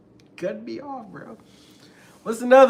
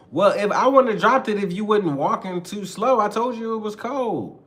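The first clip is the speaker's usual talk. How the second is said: high, louder mock protest; defensive blaming; 6 semitones higher, 4 dB louder than usual. Girlfriend blaming him for dropped glass, high and defensive. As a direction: throw the blame back, pitched up. Acted partner shifting blame.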